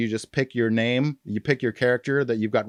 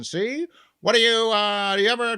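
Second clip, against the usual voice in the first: Edward G. Robinson; 10 semitones higher than usual. Retold or imitated. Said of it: "Edward G. Robinson".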